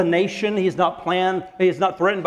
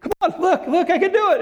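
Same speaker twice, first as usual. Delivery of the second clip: put-on much higher, laughing child voice; giggly little kid insisting; insistent toddler; 13 semitones higher, 3 dB louder than usual. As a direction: squeal like a toddler begging to be watched. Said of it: "three-year-old that goes".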